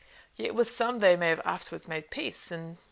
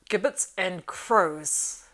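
slow, venomous, louder hiss; 6 dB louder, much slower than usual. A narrator's reading. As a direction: spit the words like a striking snake. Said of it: "he hissed".

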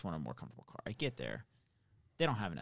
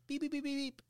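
high-pitched electronic beeping; rapid high beep noises; imitated collar beeps. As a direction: imitate a warning beeper in a high squeak. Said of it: "it, like, beeps".

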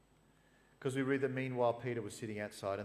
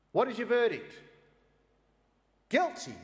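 much higher and loud, a mob's cry; shouted verdict; 14 semitones higher, 13 dB louder than usual. Acted. Council shouting its verdict against Jesus, loud. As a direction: yell it like an angry crowd. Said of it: "they shouted".

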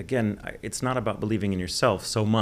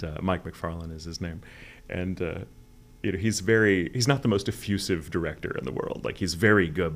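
usual speaking voice

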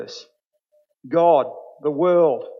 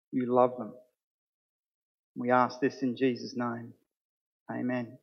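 prayer. Acted. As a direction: pray it gently to the Father. Leads congregation in prayer.

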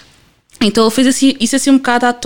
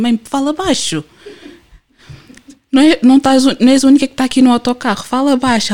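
higher-pitched mock scolding of a parent; exasperated telling-off; 5 semitones higher than usual. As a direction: retell an exasperated plea to mum, rushing the middle. Repreensão encenada à mãe no autocarro. (repreensão exasperada, imitada)

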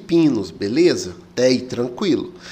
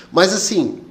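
normal talking voice

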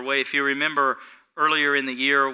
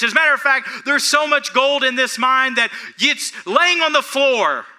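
loud, high-pitched hard sell; hyped salesman pitch; 11 semitones higher, 8 dB louder than usual. Retold or imitated. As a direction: sell it like a shouting huckster. Voices pushy young mine salesman.

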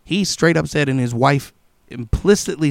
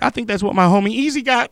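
worried thought; 8 semitones higher, faster than usual. Retold or imitated. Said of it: "But I was like".

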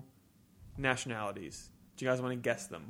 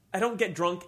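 ordinary talk, nothing marked

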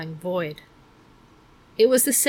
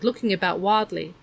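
ordinary delivery

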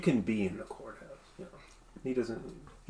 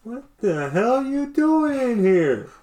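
slow, higher, louder janitor bark; gruff janitor caught off guard, slow and higher; angry janitor; 11 semitones higher, 7 dB louder, much slower than usual. Acted. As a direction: catch the intruders, drawn out and loud. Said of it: "he's like".

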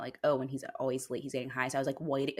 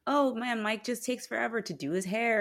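higher-pitched put-on casual chatter; feigned nonchalance; 8 semitones higher than usual. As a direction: pretend there's nothing going on, pitched up. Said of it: "having to pretend like".

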